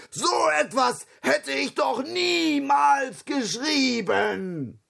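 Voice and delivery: high, slow indignant roar; outraged lion shouting his protest. A narrator's reading. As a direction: bellow it as the offended lion. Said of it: "brüllte der Löwe".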